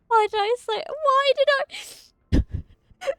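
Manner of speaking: high laughing squeal; laughing embarrassment. Real, not acted. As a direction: laugh at your own blunder. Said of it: "laughing at own slip".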